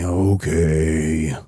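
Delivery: put-on a reluctant, grudging okay from a small boy; lightly voiced sulky toddler agreeing; begrudging okay. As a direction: give in unwillingly. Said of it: "And he begrudgingly".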